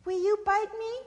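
high, loud questioning voice; wary Sister Possum. Acted. Possum to trapped snake, high wary question. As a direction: ask warily in a high possum voice.

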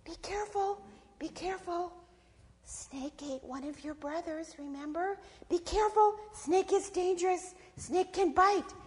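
high, small warning voice; little inner voice. A narrator's reading. Little voice inside possum warning of snake, high and cautionary. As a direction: caution in a tiny high-pitched voice. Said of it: "a little voice inside Possum said".

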